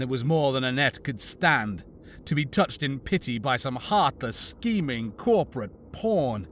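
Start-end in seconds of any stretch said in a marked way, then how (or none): none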